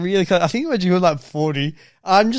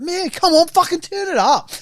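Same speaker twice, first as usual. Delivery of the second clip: high, pushy mock bro voice; hammed-up party mate egging him on; rowdy passenger; 12 semitones higher than usual. Passengers urging the driver to crank music, high and pushy.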